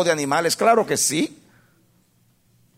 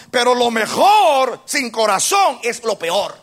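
preaching shout; 5 semitones higher, slower than usual. Real, not acted. Preaching climax over music.

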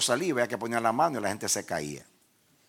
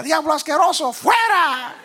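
imitating his younger self, very high loud exorcism shout; shouted rebuke; 10 dB louder, slower than usual. Preacher copying what he used to say to the devil, shouted rebuke. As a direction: scream the devil out, hammed-up. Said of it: "así que yo también decía".

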